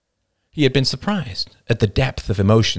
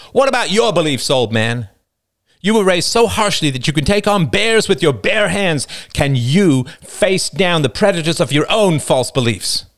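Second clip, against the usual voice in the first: higher-pitched passionate challenge; David's impassioned speech; 9 semitones higher, 3 dB louder than usual. Acted. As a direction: hurl the questions back at him. David confronts Roman.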